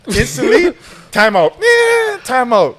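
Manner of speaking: high, loud re-enacted call; calling timeout. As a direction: cry out for a timeout.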